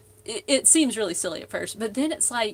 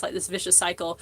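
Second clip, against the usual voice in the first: normal talking voice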